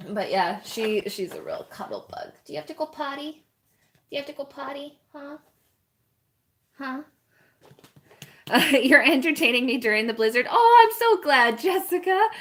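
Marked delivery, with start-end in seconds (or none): 2.4-7.0 puppy talk. Real, not acted. Asking the puppy in baby talk. 10.5-12.3 loud, very high gushing thanks; delighted. Real, not acted. Thanking a viewer.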